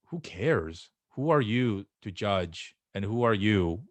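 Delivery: normal talking voice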